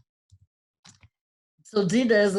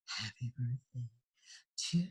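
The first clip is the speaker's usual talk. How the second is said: soft breathy imitation, much softer; breathy Marilyn Monroe; 5 semitones lower, 11 dB quieter than usual. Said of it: "she had a breathy voice".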